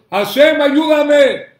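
loud, high call of Hashem, ayúdame; loud cry. Shown as a demonstration. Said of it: "con voz fuerte".

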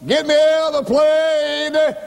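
high, long-held scream; wailing cry, each phrase stretched out high; hollering for help. Retold or imitated. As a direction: re-enact a trapped man's desperate holler. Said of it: "and he hollered".

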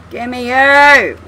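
loud, drawn-out mock demand; demanding yell. Player yells at an out-of-reach rope, demanding yell. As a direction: yell it, stretching the last word. Frustrated plea to a game rope.

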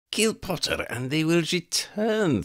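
high, cold voice of a female vampire plotting murder; scheming vampire woman. Acted. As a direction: savour each threat like a predator. Elena urges.

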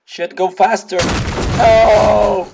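high, loud, rising to a yell; panicked urging. Real, not acted. Still fleeing in game.

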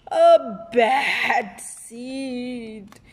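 very slow, dragged-out growl; menacing drawl. Acted. Seed declares himself bad again, very slow. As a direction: savour the words darkly.

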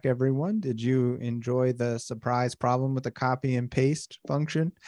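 usual speaking voice

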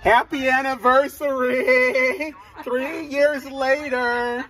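high, drawn-out cheer; whooped anniversary greeting; celebratory call. Real, not acted. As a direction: stretch each word in a high singsong hail.

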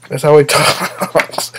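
laughing through the words; cracking up. Real, not acted. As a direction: break into real laughter at your own impression. Cracks up after own impression.

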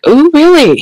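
high rise and fall of mock amazement; surprised. Woman answering a self-declared alien, surprised. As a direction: swoop up high in re-enacted surprise. Acting reaction to alien's claim.

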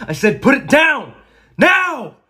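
very high, loud yell; furious shouted order. Acted. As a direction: scream the command at the gunman.

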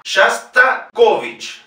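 Shastakovich very slowly, "shas-ta-KO-vich"; unstressed Os as A. Shown as a demonstration. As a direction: separate Shastakovich, stressing the KO. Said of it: "las pronunciamos como A".

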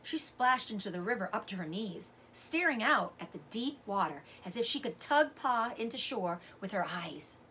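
plain reading voice

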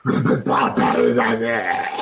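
grave et menaçante, plus forte; lâche-le d'une grosse voix de poupée maudite; voix grosse bizarre. Acted. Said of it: "Une voix bizarre". (grosse voix grave et menaçante façon Chucky)

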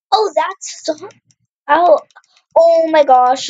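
ordinary talk

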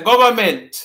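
government as guv-ment, dropped letters; Patois government. Shown as a demonstration. Teacher modeling the Patois pronunciation, government as guv-ment. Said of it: "we don't pronounce all our letters in Patois".

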